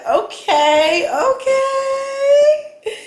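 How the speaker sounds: giggly high okays; laughing. Real, not acted. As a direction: laugh through the words. Reacting to the pop-out screen.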